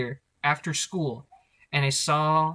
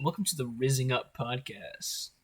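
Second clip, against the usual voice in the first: soft, low crooner intro; deep rich voice; 2 semitones lower, 6 dB quieter than usual. Retold or imitated. Doing the crooner voice to open the podcast. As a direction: purr it like a smooth radio host. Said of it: "I can't do the voice".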